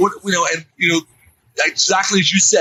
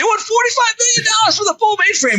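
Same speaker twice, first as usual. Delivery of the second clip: high, screaming outrage at the price; mock-screamed disbelief at the price; retold scream. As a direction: shriek it in disbelief like the whole room is yelling. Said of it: "He's literally screaming".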